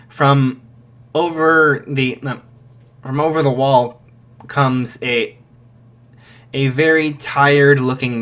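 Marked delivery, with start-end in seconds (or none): none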